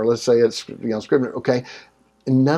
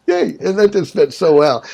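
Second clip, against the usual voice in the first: mock cheer; 6 semitones higher, 6 dB louder than usual. Mock cheer at naive belief.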